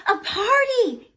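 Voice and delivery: high, loud delighted gasp. A narrator's reading. Children seeing the party table, gasping with joy. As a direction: burst out in surprise. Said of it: "gasped the children".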